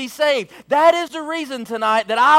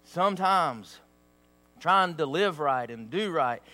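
low, hushed pleading to the congregation; soft earnest preaching; 8 semitones lower, 9 dB quieter than usual. Acted. Preacher gently warning his church, softly and low. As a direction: lean in and plead quietly with them. Quiet heartfelt stretch of sermon.

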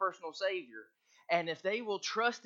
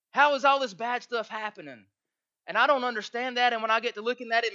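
loud, high preacher's cry of dismay; preaching shout; 5 semitones higher, 7 dB louder than usual. Real, not acted. Preacher crying out to the church in bewilderment, loud. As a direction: belt it out to the congregation in disbelief. Preacher rising to full force.

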